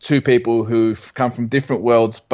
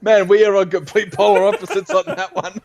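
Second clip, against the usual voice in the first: high-pitched laughing through the words; can barely talk for laughing; real helpless laughter while speaking; 11 semitones higher than usual. Real, not acted. Cracking up at the failed guess.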